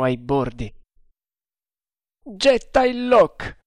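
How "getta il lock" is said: high, loud, slow command; shouted order. A narrator's reading. Haider ordering the log thrown, loud shout. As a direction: call it across the deck. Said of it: "gridò Haider".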